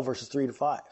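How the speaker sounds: normal talking voice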